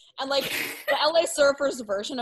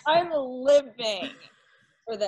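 delighted; 4 semitones higher, 6 dB louder, slower than usual. Real, not acted. Echoing delight, stretched slowly.

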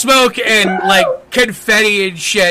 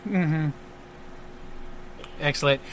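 ordinary delivery

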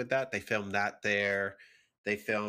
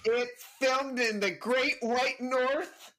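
dramatic announcer reveal; 12 semitones higher, slower than usual. Pretend. Dramatic reveal of filming location.

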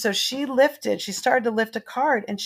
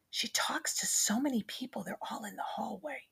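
put-on secretive child's whisper; 3 semitones higher, 13 dB quieter than usual. Little girl whispering to grandma about unseen people. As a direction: lean in and whisper it. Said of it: "Dylan leaned over and she goes".

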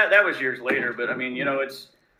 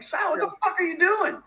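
incredulous yell at Sal. Retold or imitated. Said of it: "I'm like".

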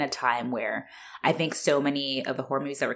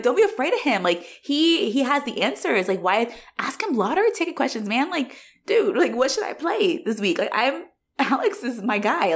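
eager fan; 7 semitones higher than usual. Pretend. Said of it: "I would also be like".

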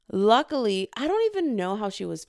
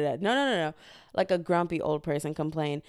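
usual speaking voice

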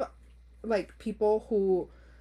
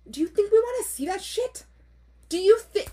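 high, loud, disgusted disbelief; indignant outburst; 11 semitones higher, 6 dB louder than usual. Real, not acted. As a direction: fire off an affronted rhetorical question. Mock outrage at the submitted story.

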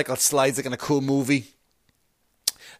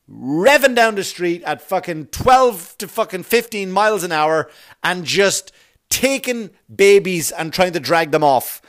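normal talking voice

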